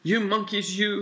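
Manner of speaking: high-pitched storybook scolding. A narrator's reading. Peddler telling monkeys off for taking caps, high scolding. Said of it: "shaking a finger at them".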